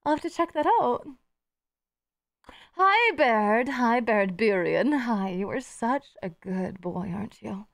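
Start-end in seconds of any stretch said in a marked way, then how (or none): 2.8-7.7 pet talk. Real, not acted. Cooing to a pet.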